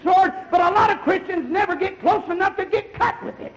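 loud, driving sermon voice building to a shout; fiery preacher calling out to the church; full-force preaching. Acted.

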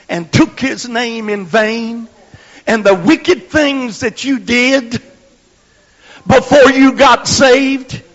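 loud, high, slow hellfire preaching; preacher shouting at the congregation; full-force preaching. Real, not acted. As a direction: pound the congregation with it.

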